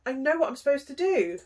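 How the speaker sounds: bursting out high and loud with realisation; a sudden "I know!" as it all clicks; sudden epiphany. Retold or imitated. Client suddenly realising her calling, excited. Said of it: "it's like an epiphany".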